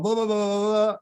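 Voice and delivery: very high-pitched mock jabber; high nonsense chatter mimicking someone; imitated guy jabbering. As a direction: babble it high like a cartoon imitation.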